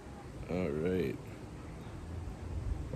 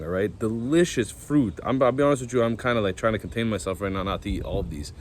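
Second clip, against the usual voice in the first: ordinary talk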